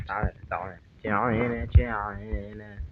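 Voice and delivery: soft slow coaxing; coaxing lure. Pretend. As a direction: lure him in gently. Coaxing enemy softly.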